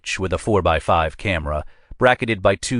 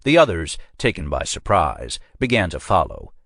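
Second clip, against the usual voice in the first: ordinary delivery, nothing marked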